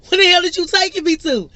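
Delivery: put-on high, loud laughing boy what; excited disbelief. Surprised by a trip, she shrieks with laughing excitement. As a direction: shriek it out in delighted shock. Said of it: "I say".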